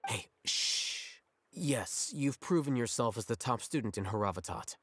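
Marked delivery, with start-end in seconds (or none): 0.1-1.1 quick whispered shush; hushing whisper. Acted. Alhaitham silencing the chattering student, whispered hush. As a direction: cut him off with a hushed shh.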